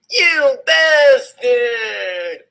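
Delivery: high, slow hammed-up yell; mock angry shout. A narrator's reading. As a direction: bellow it with fake fury. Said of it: "I shouted angrily".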